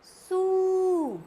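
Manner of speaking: सू with a long drawn oo; long ū vowel; long oo sound. Shown as a demonstration. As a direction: stretch the oo in सू. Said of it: "बड़े ऊ की मात्रा लगा दी हमने".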